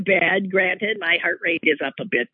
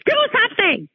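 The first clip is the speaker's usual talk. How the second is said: 2 high, pleading demand; 3 mimic a wife urging her husband on; 1 demanding woman. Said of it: "this is where a woman says".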